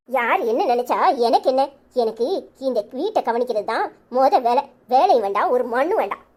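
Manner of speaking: ordinary delivery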